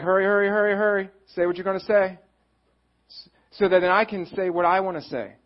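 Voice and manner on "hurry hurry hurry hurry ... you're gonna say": pushy, louder, higher hurry hurry; impatient hurry. Retold or imitated. Inner voice rushing the other person, loud and impatient. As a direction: urge them on, can't wait to talk. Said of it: "So I'm like".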